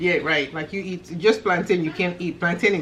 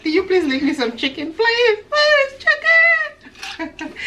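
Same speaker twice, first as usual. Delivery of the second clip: high, pleading whine; begging whiny kid; 12 semitones higher, slower than usual. Retold or imitated. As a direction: beg for chicken like a whiny child. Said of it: "He was like".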